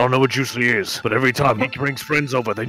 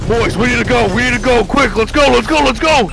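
high, loud hurried urging; urgent panic; 11 semitones higher, 5 dB louder, faster than usual. Acted. Narrator hurries boys away from Juicy, urgent panic. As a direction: shout them out of danger.

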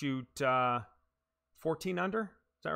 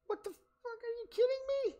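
squeaky, much higher cry of disbelief. Real, not acted. Golfer stunned his shot fell short, high disbelief. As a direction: shriek it like you can't believe it.

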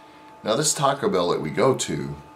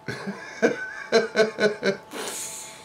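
laughing. Real, not acted. Laughing at own joke.

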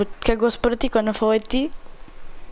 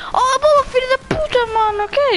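frustrated shout; 11 semitones higher than usual. Real, not acted. Angry at the ball in game.

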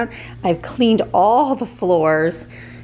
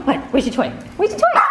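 excited pet talk; 5 semitones higher than usual. Real, not acted. Mom hyping up the dog to find her toy, higher excited pet voice.

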